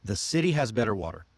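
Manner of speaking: CITY, BETTER, WATER flapped, fast and falling; smooth flap T. Shown as a demonstration. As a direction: let the Ts in city, better, water melt into quick Ds. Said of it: "See how smooth that".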